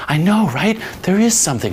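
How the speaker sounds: high-pitched imitation of an excited girl; mock girlish enthusiasm; girl eagerly agreeing. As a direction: play her delighted agreement, voice way up.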